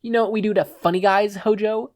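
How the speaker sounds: mock him before the drop; taunting thug. Setsuno taunting Izuku to Hojo, sneering.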